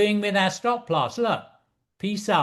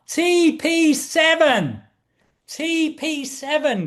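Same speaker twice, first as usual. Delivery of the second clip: excited; 7 semitones higher, slower than usual. Real, not acted. Trader thrilled his target hit, excited and high. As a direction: let the thrill of the win carry the words up. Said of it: "Look, I get excited".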